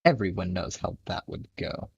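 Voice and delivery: ordinary delivery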